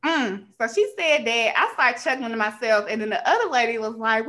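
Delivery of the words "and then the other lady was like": ordinary talk, nothing marked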